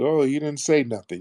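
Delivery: normal talking voice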